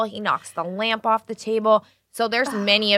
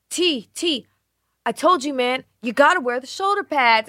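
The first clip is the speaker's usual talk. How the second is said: raised, emphatic coach's call; retold football coach; 6 semitones higher, 4 dB louder than usual. Sleep-talking husband coaching friends at football, insistent. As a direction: call out to a player, high and insistent. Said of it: "he was coaching football".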